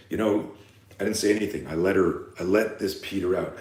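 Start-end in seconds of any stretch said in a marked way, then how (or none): none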